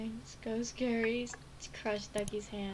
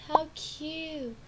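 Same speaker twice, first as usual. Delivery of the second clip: ordinary talk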